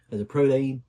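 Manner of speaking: badly faked Australian twang; terrible Australian accent. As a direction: put on a bad Australian accent, punching it out. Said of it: "that's a terrible Australian accent".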